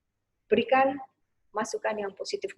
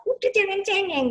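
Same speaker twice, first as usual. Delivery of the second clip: high, loud scolding mother; mock scolding parent, high and loud; 10 semitones higher, 6 dB louder than usual. Shown as a demonstration. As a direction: snap at the crying child.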